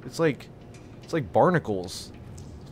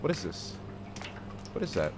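hushed curiosity; 10 dB quieter than usual. Real, not acted. Quietly curious at find.

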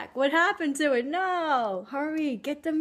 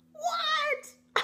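high squeak of mock surprise; surprised. Host squeaks in mock shock at Murdoch's card, high. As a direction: yelp it up high in disbelief.

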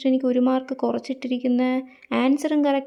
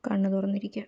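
whisper; 6 dB quieter than usual. Acted. Whispering to self, sleepy.

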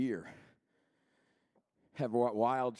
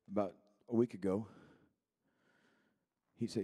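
normal talking voice

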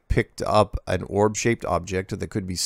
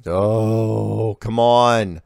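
exasperated groan; 3 semitones higher, 5 dB louder, much slower than usual. Real, not acted. To a viewer asking about meteorites once more, an exasperated protest. Same meteorite question yet again.

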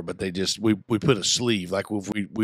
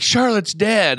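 put-on high child's voice, louder; imitating daughter; 10 semitones higher, 5 dB louder, slower than usual. Father imitates daughter's April Fools prank, high child voice. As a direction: play the kid's fake bad news. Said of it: "she come out like".

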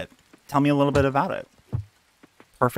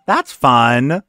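sarcastic outburst; 3 semitones higher, 13 dB louder than usual. Real, not acted. Sarcastic realization.

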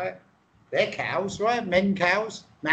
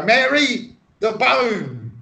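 louder, higher call to a wife; husband calling out; 4 semitones higher, 3 dB louder, slower than usual. Acted. Husband home at night to wife, calling out. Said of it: "he used to go".

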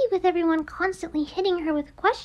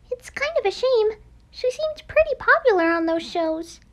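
ordinary talk, nothing marked

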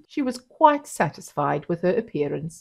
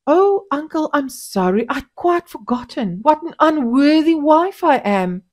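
higher, sing-song fake contrition; mocking apology; 9 semitones higher, 6 dB louder than usual. A narrator's reading. Wife mocking husband with a high fake apology. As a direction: overdo the sorry to mock him. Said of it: "Noumbe was mocking him".

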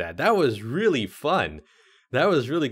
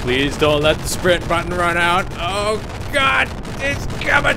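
panicked high shrieking, much louder; scared horror game streamer; 13 semitones higher, 7 dB louder than usual. Real, not acted. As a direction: scream in terror while being chased. Chased by monster in maze.

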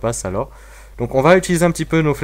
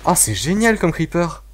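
excited delight; 6 semitones higher, slower than usual. Real, not acted. Découvre un nouveau creeper.

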